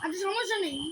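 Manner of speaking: normal talking voice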